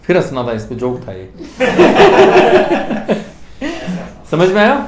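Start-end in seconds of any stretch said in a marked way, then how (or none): 1.3-4.1 hearty laugh. Real, not acted. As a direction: burst out laughing. Teacher laughs at own joke.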